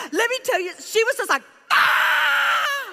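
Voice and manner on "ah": high startled shout; a sudden gasping yell of shock; stunned cry. Retold or imitated. Stranger at the bus stop stunned by the money, shouting high.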